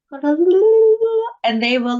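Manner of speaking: high, slow, sing-song lilt; fairy voice. Retold or imitated. Imagining fairies answering her tantrum, high and slow. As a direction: stretch it out like a fairy promise.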